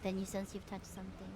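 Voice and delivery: ordinary talk, nothing marked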